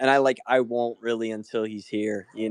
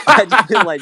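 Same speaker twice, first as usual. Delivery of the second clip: bursting into laughter, higher and louder; laughing; 10 semitones higher, 11 dB louder than usual. Real, not acted. Admitting to drinking at three PM, laughing loudly. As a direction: crack up while repeating it. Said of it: "repeats the phrase, laughing harder".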